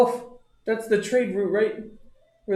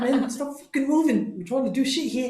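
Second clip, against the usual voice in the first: annoyed yell; 10 semitones higher than usual. Retold or imitated. Annoyed at the moving caravan.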